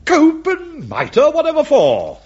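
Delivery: high, indignant incredulous query; astonished. Acted. Bishop told what to wear at matins, astonished. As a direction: stretch the words in amazed protest.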